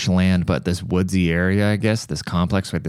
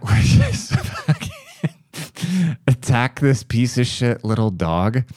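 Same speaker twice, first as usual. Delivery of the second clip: high, giggling through the story; can barely get it out for laughing; laughing through words; 5 semitones higher, slower than usual. Real, not acted. Telling a friend about the cat, laughing hard.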